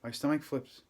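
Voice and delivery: ordinary delivery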